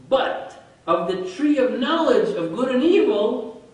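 slow, loud reading of the verse; solemn scripture reading. Acted. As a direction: declaim the warning verse. Reads Genesis verse aloud.

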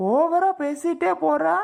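high, drawn-out angry complaint; slow, heated scolding; exasperated. Acted. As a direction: stretch it out high, out of patience. Husband's patience runs out in quarrel.

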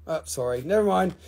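normal talking voice

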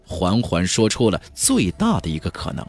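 usual speaking voice

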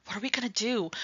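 whispered "What are we gonna do?" in panic; frightened whisper. Acted. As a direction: hush it out, scared of the cops. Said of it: "then turns back to the older kid and says".

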